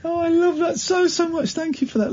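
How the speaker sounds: high, soft, over-sweet thanks; gushing mock delight. Real, not acted.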